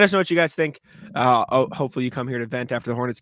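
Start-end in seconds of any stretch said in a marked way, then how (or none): none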